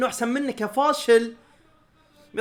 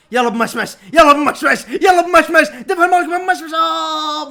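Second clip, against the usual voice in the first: loud, high cheering for the attacker; cheering shout; 8 semitones higher, 10 dB louder than usual. Real, not acted. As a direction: yell him forward at the screen.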